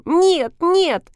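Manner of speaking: put-on high-pitched, eager little-girl voice; excited child. A narrator's reading. Schoolgirl jumping as she corrects Max, excited.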